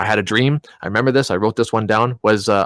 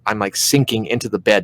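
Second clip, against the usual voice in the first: usual speaking voice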